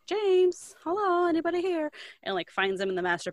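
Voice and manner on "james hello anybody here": high singing-out call into a house. Retold or imitated. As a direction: imitate a visitor calling for whoever is home. Acts entering house, calling for neighbour.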